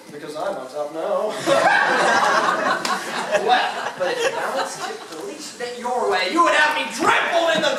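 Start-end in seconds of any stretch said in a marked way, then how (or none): 1.4-3.3 gloating laugh. Acted. Mayor triumphs over merchants.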